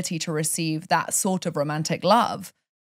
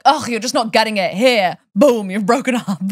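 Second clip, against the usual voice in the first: higher, louder, mock-stern universe; 4 semitones higher, 7 dB louder than usual. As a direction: boom it out like the cosmos scolding. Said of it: "visualize the universe being like".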